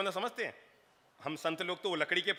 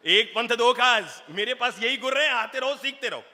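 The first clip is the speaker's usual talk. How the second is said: high, loud preacher's call; fiery preaching; 7 semitones higher, 8 dB louder than usual. Acted. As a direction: drive the lesson home to the crowd. Preaching at full force. (जोशीला प्रवचन)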